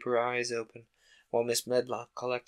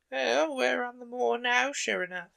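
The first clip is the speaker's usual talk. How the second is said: higher housekeeper voice; Miss Medlock voice; 12 semitones higher than usual. Acted. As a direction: answer the child matter-of-factly. Medlock on the moor.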